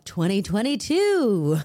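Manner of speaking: ordinary talk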